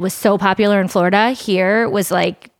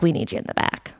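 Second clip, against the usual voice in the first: a quiet, grave summons in a near-whisper; soft unvoiced murmur of a manager pulling someone aside; hushed ominous whisper; 4 semitones lower, 6 dB quieter, faster than usual. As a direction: whisper it low like a boss calling you in. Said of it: "they were like".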